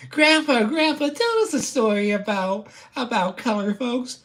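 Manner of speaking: very high, loud pretend kid; put-on grandchild voice. Grandchild begging Grandpa for a story, squeaky mock child voice. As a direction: pipe up like an eager grandchild. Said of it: "This is what the pipe reminds me of".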